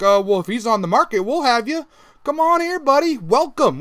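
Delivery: high, eager welcoming voice; a chummy high-pitched invite; mock welcoming. United welcoming Di Maria, high mock-eager voice.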